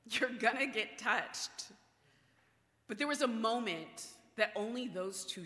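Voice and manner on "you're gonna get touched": loud, exasperated mock disbelief; sassy. Disciples to Jesus in a packed crowd, sassy exasperation. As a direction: scold him like an incredulous buddy. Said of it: "so sassy that the disciples are also like".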